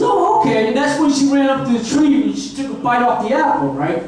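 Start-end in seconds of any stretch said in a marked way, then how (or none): 0.0-0.7 high, giddy agreement; high Eve voice. Acted. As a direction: agree eagerly, girlish. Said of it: "She says".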